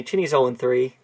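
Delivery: normal talking voice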